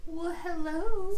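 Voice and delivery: sultry, loud flirty greeting; femme fatale. Pretend. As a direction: purr hello to the cat like a seductress.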